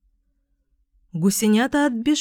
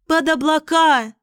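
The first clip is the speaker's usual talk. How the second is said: higher, eager gosling shout; goslings cheering; 7 semitones higher, 3 dB louder than usual. A narrator's reading. Goslings shouting to fly up, higher and eager. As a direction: cry out with young excitement. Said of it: "закричали гусенята".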